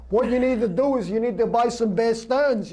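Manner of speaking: mocking a TV finance host in a lightly put-on Brooklyn accent; pushy Brooklyn accent selling stock tips; Maria Bartiromo Brooklyn accent. Mocking a TV host advising Iowa viewers, light Brooklyn accent. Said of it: "that fucking Brooklyn accent".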